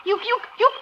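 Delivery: ordinary talk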